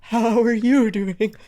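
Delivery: high, shivery greeting; pretend freezing. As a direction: greet them as if freezing cold. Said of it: "Oh, Jet's freezing".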